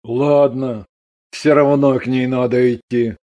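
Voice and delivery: low resigned husband; sighing, resigned. A narrator's reading. Husband decides he must see the daughter, resignedly. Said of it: "Ганин вздохнул".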